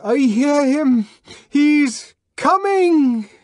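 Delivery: loud, high shout; man fakes ecstasy by shouting; forced shout. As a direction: shout along to fit in. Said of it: "jumped up and shouted".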